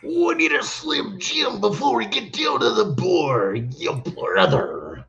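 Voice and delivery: high, slow Macho Man drawl. Retold or imitated. Friends in the Uber, hamming up Macho Man. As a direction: stretch it out like Randy Savage. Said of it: "doing Macho Man voice".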